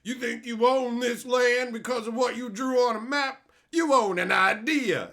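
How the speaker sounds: high, loud sneering preachy voice; mock the self-righteous writer; hammed-up smug lecture. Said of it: "some guy who's like".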